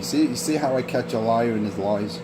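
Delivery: ordinary talk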